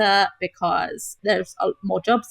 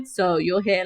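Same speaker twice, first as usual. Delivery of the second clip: normal talking voice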